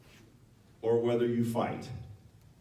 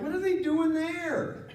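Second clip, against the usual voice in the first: incredulous; slower than usual. Retold or imitated. Nevadan baffled at news.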